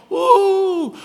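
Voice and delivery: very high-pitched, loud whoop; long falsetto holler; excited woo. Real, not acted. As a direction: let out a high teasing "woo". Hollers at the heart tattoo remark.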